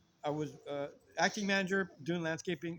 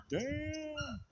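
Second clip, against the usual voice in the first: long, high, soft "damn"; awed; 9 semitones higher, 5 dB quieter than usual. Retold or imitated. Storyteller reacting to his brother's fight, awed. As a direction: stretch it out in hushed amazement.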